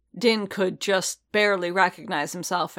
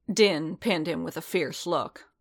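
ordinary delivery, nothing marked

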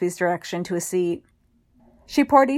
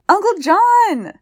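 put-on high, slow, loud child's call across the yard; a small boy shrieking with joy at his uncle; excited child crying out; 13 semitones higher, 8 dB louder, much slower than usual. A narrator's reading. Nephew running to greet his uncle, high excited child's cry. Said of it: "the creature cried".

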